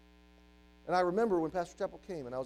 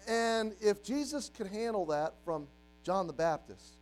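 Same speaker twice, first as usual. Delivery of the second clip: ordinary delivery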